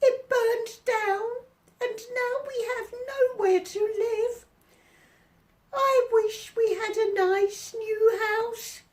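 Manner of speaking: very high squeaky crying voice; mother mouse squeaking in distress. A narrator's reading. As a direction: squeak it like a tiny upset mouse. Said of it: "squeaked the mother mouse".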